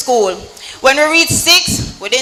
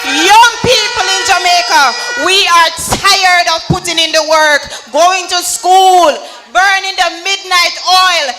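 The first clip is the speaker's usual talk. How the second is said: rally speech; 5 semitones higher than usual. Real, not acted. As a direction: rouse the crowd with conviction. Fiery youth rally speech.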